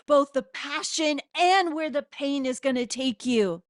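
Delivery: ordinary talk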